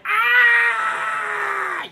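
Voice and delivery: long, very loud high scream; imitated stage scream, high and piercing; yell. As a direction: let out a sustained full-volume yell. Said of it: "whip the floor and yell".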